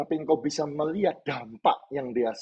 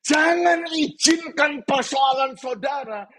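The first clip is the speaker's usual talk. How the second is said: full-force preaching; 12 semitones higher than usual. Real, not acted. Preacher urging congregation not to let problems silence them, full-force preaching. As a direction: urge them with mounting force.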